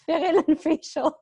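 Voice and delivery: teacher rereading a student's typo, laughing. Real, not acted. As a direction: savour the funny typo through giggles.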